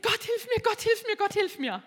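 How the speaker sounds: reliving a frantic walk to the office, pleading; frantic plea. Retold or imitated. Frantic repeated prayer.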